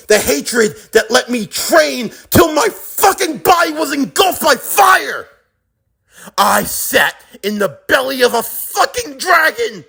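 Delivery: high, impassioned shouting; raging climax. Acted. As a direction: roar out the ordeal with building fury. Climax of acted rant.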